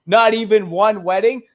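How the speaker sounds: high, loud, sneering "whoa, dial it back"; hammed-up establishment scoff; mocking dismissal. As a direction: brush off the anti-war critic as a purist. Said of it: "It's just like".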